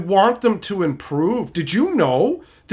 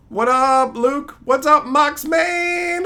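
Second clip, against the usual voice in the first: drawn-out greeting; 7 semitones higher, slower than usual. Pretend. Greeting a chatter.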